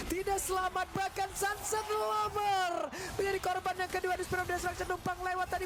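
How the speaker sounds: rising hype play-by-play; esports caster. Acted. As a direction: call the fight, building excitement. Hyped casting of a teamfight.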